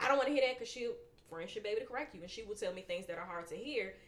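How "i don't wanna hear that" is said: higher, louder mock protest; imitated raised-voice pushback; acted refusal. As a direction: refuse to hear it, playfully acted. Voices pushback to a friend's correction.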